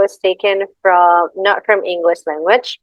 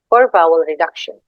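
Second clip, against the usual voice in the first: usual speaking voice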